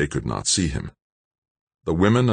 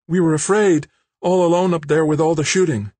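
high, loud frightened woman's voice; shaky; 13 semitones higher, 5 dB louder than usual. A narrator's reading. Kimberly explains to gunmen, frightened and high. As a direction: plead fear in a high, urgent voice. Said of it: "Kimberly said shakily".

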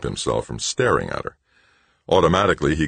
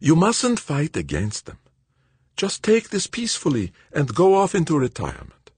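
put-on higher, quicker voice for the anxious old friend; Verban, worried old colleague; 13 semitones higher than usual. A narrator's reading. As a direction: voice Verban as tense and urgent. Verban's voice in the read story.

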